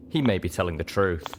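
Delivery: ordinary delivery, nothing marked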